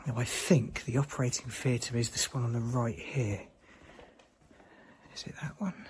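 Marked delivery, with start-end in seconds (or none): 5.1-5.8 hushed whisper in the dark. Real, not acted. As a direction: breathe the question, scared. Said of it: "I can't tell you how scared I am".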